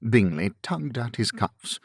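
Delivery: ordinary delivery, nothing marked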